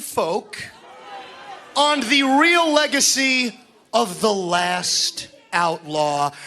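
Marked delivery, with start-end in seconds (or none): 3.9-6.3 "last" leaned on, then left hanging; stress on "last". Acted. Wrestler schools the fans on his rival's legacy, "last" stressed. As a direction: put deliberate weight on the word "last". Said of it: "Emphasis on last".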